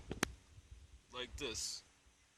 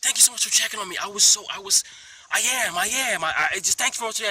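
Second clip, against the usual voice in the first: higher, louder, over-the-top thankful voice; gushing gratitude; 9 semitones higher, 9 dB louder than usual. Pretend. Showing how some people answer the question, gushing and high. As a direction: gush your thanks, way too much. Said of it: "some people can say".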